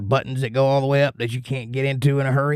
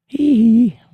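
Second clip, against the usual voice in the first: high squealed hee hee like the King of Pop; [name] hee hee; 13 semitones higher, 8 dB louder than usual. Retold or imitated. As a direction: yelp a shrill falsetto hee hee. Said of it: "[name] gloves".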